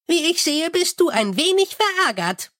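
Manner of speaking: high, chirpy little imp. Acted. Imp soothes the angry Mumm, high and chirpy. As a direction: pipe it up high like a tiny helpful imp. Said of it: "sagte der Kobold".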